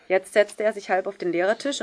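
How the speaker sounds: ordinary delivery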